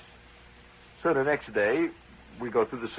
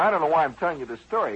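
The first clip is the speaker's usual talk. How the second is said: normal talking voice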